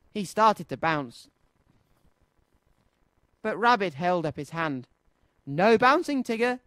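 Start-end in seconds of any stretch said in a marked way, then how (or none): 5.5-6.6 high, loud reprimand; firm scolding. A narrator's reading. Rabbit holds up his hand to Tigger, loud scolding. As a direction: stop them short with a firm order.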